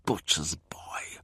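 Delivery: slow, low hissed whisper; whispered slur as he leaves. Acted. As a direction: breathe the insult under your breath. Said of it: "he whispers".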